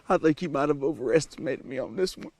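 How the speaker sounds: put-on cowboy accent. Apologizing to fans and Amanda, in fake Texan accent. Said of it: "I don't even have an accent".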